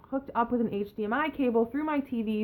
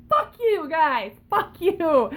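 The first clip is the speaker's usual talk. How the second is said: mock angry; 6 semitones higher, 5 dB louder, slower than usual. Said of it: "and I'm just like".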